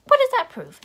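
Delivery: loud, high burst of disbelief; incredulous outcry. Acted. Mock outrage at a silly scene.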